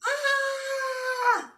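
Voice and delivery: long, sustained high-pitched "Ah" scream; high frustrated scream. Shown as a demonstration. Coach releasing frustration to show the group, high held scream. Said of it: "I'm gonna scream".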